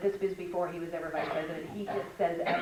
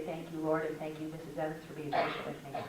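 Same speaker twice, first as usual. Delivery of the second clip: softer, grateful prayer; quiet thankful prayer; 4 semitones lower, 7 dB quieter than usual. Retold or imitated. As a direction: hush it, grateful to the Lord.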